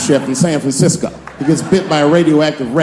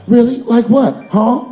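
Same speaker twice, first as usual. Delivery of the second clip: high, louder challenge; mock probing producer; 8 semitones higher, 4 dB louder, slower than usual. Producer pushing the comic for ideas, high and probing. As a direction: call the bluff in a high prodding voice. Producer calling his bluff.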